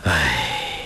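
low, drawn-out weary sigh; long deep sigh. A narrator's reading. Man sighs over the three once kneeling visitors, long and deep. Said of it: "深长地叹息一声".

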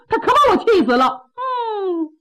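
tearful, voice breaking into a sob; crying. Acted. (大个子气得哭了出来)

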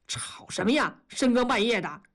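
ordinary delivery, nothing marked

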